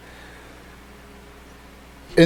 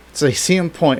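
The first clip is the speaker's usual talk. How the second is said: ordinary delivery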